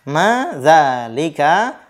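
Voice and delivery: Ma dzalika drawn out slowly with a rising question tune; teacher modelling a question, slow and clear; slow model question. Shown as a demonstration. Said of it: "kalian bisa lihat di sini".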